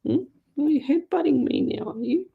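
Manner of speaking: high cooing pet talk. Real, not acted. Talking to headbutting cat.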